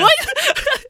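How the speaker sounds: very high, loud disbelieving squeal; laughing, incredulous high exclamation; astonished yelp. Retold or imitated. Co-host reacting to the Hadoken scene, laughing high-pitched disbelief.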